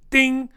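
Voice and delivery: high-pitched sung-out ding; ding of a bell. Pretend. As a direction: chime it like a little bell. Ticking off list items.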